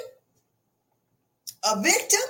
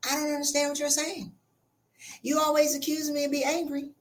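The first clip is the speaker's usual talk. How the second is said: fake innocent calm; 6 dB quieter than usual. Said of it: "you be like".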